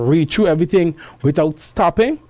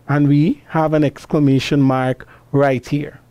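ordinary delivery

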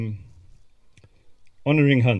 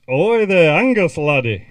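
loud, higher Scottish "Ahoy there, Angus, laddie" with a laugh; mock Scottish hail; 5 semitones higher, 9 dB louder than usual. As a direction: hail your friend in a hammed-up Scottish brogue. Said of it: "if he was feeling particularly Scottish".

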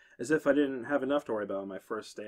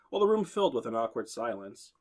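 ordinary delivery

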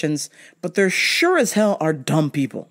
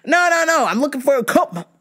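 no no no, pitched up and pushy; insistent customer; 9 semitones higher than usual. Retold or imitated.

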